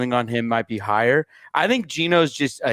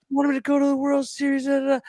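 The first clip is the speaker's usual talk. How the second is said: put-on much higher, quick childish whine; high, fast mimic of a crying kid; imitating upset son; 12 semitones higher, faster than usual. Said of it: "he goes".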